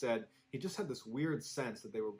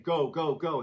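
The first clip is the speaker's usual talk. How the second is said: louder, higher urgent calls; 4 semitones higher, 7 dB louder than usual. Retold or imitated. Father rushing his kids out of the haunted house, urgent call. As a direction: hurry them out the door.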